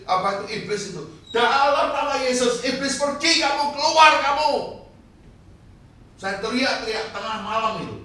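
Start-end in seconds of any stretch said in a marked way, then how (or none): 1.3-4.5 full-force high shout casting out the devil; loud, high exorcism command, retold at full force; re-enacted rebuke of the devil. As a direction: act out driving the devil away, building louder. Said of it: "Langsung saya tengking".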